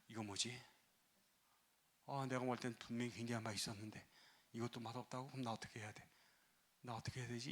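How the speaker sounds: as if talking to herself in shock; a lost, hushed bride; dazed murmur. Retold or imitated. Bride's stunned inner voice.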